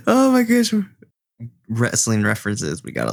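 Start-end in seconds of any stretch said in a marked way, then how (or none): 0.0-0.7 gasping oh my gosh. Real, not acted. Player to the table, gasping and delighted. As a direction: catch your breath in delight. Realising the wrestling reference.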